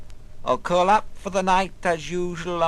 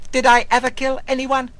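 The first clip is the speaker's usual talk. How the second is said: urgent inner question; 8 semitones higher, 6 dB louder, faster than usual. Acted. Quoted tormenting inner question.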